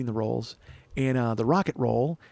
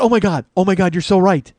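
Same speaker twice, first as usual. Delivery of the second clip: loud, higher mimicked shock; startled agreement; 5 semitones higher, 9 dB louder than usual. Friend seeing the lookalike photo, startled. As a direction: imitate a friend jolted by recognition. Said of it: "Like, like she jumped".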